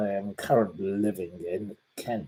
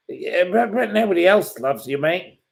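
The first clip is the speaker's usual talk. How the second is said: taunting jeer; 8 semitones higher, 4 dB louder than usual. Real, not acted. Taunting the troll.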